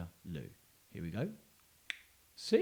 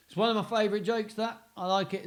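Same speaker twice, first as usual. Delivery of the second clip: ordinary delivery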